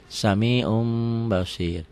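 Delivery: SAMI'UN as "sami'um", very slow; iklab reading. Shown as a demonstration. Teacher repeats the reading, long held m. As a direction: hold the hummed m before basir. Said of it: "dibacanya jadi sami'un".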